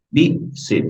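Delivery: "Be, sit" as two separate beats; split into syllables. Shown as a demonstration. Teacher counting syllables for students, "Be, sit" split in two. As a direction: break the word into "Be, sit".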